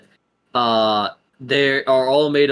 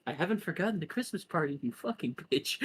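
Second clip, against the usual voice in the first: much softer, fast, menacing undertone; sudden venom; 5 semitones higher, 11 dB quieter, faster than usual. Acted. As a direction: drop to a hissed threat. Sister's sudden spiteful turn.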